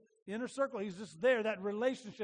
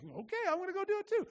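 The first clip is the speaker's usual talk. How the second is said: eager copycat; 9 semitones higher, faster than usual. Retold or imitated. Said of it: "You're like".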